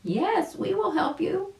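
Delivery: very high bird voice; a high chirpy rescuer answering. A narrator's reading. Mama bird answers the rabbit in a very high voice. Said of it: "answered a voice".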